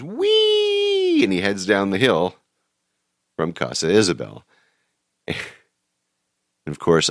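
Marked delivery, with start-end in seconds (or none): 0.0-1.2 very high, far-off squeal of glee; joyride whoop. Retold or imitated. Cabbie lets go of the wheel, a high gleeful squeal. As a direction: squeal like on a rollercoaster. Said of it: "and he goes".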